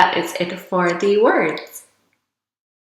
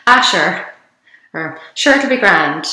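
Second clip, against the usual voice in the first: broad Irish. Shown as a demonstration. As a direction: lay on the Irish, "Ah, sure, it'll be grand". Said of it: "if I am much more Irish than that".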